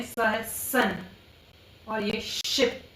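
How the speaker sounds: सन and शिप said slowly to contrast s and sh; teacher contrasting स and श; slow s and sh demo. Shown as a demonstration. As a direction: stretch each sound for the class. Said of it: "स या श".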